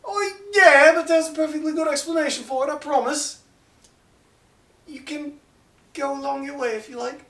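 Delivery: high, smooth, casual brush-off; a smug elf waving the party along; elf dismissing. Acted. The elf assures the party all is fine, high and smug. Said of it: "the elf looks down at him and goes".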